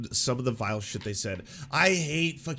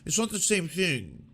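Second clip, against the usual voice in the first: mock weaselly backpedal; 3 semitones higher than usual. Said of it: "Someone's like".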